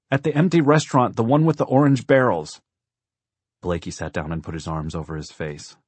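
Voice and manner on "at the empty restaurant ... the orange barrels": put-on high, loud child's answer; raised, pleading kid voice; urgent little girl. A narrator's reading. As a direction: call out your location urgently. Frightened girl gives her location.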